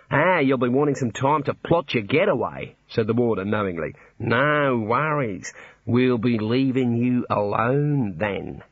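broad Aussie drawl of a jolly jailer; Australian warder. Acted. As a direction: play the cheery Australian warder.

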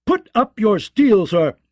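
loud, high, outraged roar; furious old lord bellowing a rebuke; wrathful command. Acted. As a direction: thunder at the knight to stand down. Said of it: "Bronze Jan rose in wrath".

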